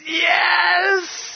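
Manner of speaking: very high, drawn-out yes; high wailing shout of acceptance; acted ecstatic cry. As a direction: cry out a joyful yes, imitating a convert. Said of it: "She said".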